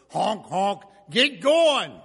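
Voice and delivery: high, loud honking impatience; impatient driver. Retold or imitated. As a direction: blare it like a fed-up driver.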